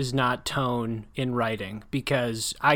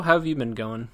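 normal talking voice

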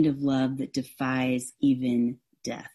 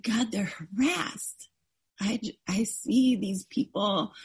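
higher voice, near tears; emotional plea; 7 semitones higher than usual. Real, not acted. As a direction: let the ache for the people show. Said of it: "pouring out heart to God".

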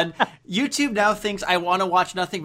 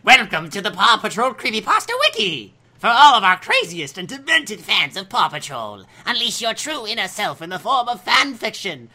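creeper voice; 6 semitones higher, 6 dB louder than usual. Shown as a demonstration. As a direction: read it like a creepy lurking thing. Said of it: "in my creeper voice".

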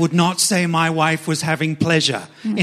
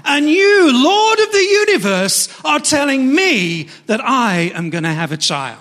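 Sarah; 6 semitones higher, 4 dB louder than usual. Retold or imitated. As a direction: challenge God with hammed-up incredulity. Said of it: "laughing 'cause she's cynical".

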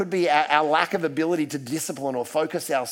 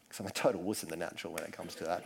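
quick quiet aside; 9 semitones lower, 12 dB quieter, faster than usual. Real, not acted. Self-deprecating aside about being wuss.

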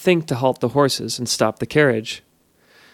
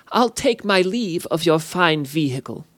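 sprite farewell; 4 semitones higher than usual. A narrator's reading. Sprite departs.

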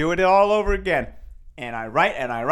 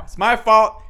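usual speaking voice

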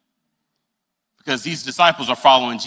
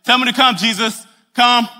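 Peter and Jesus; 7 semitones higher, 4 dB louder than usual. Pretend. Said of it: "Would you walk like this".

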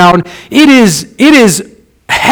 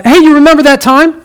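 higher, quicker chatty mock voice; casual reminiscing; 7 semitones higher, faster than usual. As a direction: toss it off like idle small talk. Acts out casual reminiscing chat.

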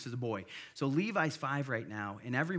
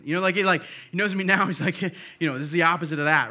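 loud laughing retelling; 5 semitones higher, 14 dB louder, faster than usual. Retold or imitated. Retelling friend laughing about it.

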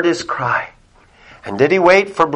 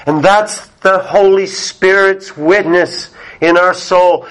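normal talking voice

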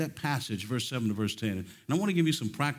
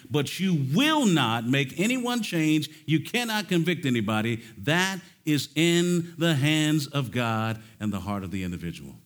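preaching climax; 3 semitones higher, 5 dB louder than usual. Acted. Preaching at full force.

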